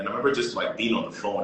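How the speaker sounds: usual speaking voice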